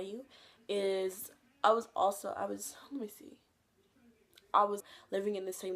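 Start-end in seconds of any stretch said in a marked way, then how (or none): 2.4-3.4 whispered thinking aloud. Real, not acted. Recalling for viewers, whispered to self. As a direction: murmur to yourself.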